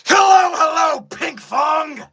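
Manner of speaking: very high, full-force battle yell on a greeting; hammed-up anime shout. Streamer yells requested greeting to a viewer, straining battle shout. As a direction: shout the greeting like a charging attack. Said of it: "You want me to say".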